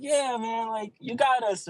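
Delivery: put-on high, joking Boston accent. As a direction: rib them in a Boston accent. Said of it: "with his Boston accent".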